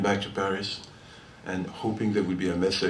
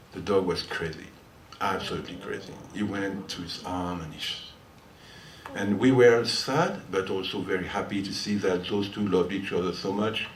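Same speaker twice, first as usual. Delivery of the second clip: ordinary delivery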